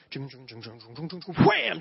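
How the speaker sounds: imitated crash sound effect; bike crash noise. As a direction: mimic a bike wobbling then crashing. Bike wobbling then crashing.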